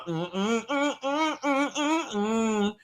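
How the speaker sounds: soft, pained "mm-mm" hums; quiet, pained refusal hum; disapproving hum. Real, not acted. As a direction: shake your head with a hum.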